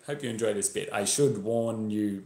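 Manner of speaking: ordinary delivery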